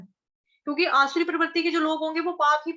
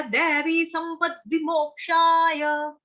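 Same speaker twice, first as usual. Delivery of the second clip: drawn-out Sanskrit chant, raised; slow recited shloka; 4 semitones higher, 3 dB louder, much slower than usual. Shown as a demonstration. Teacher reciting a Gita verse to students, slow and chanted. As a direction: intone the verse slowly. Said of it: "तो भगवान ने बताया".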